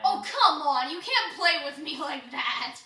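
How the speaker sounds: loud exasperated groan. Real, not acted. Exasperated reaction to video.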